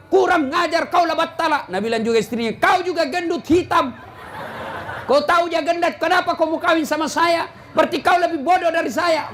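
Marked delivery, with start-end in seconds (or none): 0.1-1.6 high-pitched scolding insult; angry husband. Acted. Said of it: "Kalau dia marah, ibu juga marah". 2.6-9.3 high-pitched quarrelling retort; angry wife. Acted. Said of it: "ibu juga marah".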